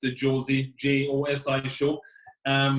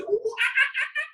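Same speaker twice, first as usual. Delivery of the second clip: high ooh-ah-ah monkey calls; monkey noises. Pretend. Doing a favourite animal for the laughing game, high monkey calls. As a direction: chatter like a monkey. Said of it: "Do you know the monkey then?".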